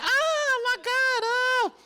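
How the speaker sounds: high squealing fangirl gasp; mock swooning girl in the audience; swooning groupie. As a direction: squeal it like a starstruck fan. Imitating groupies fighting for shirt.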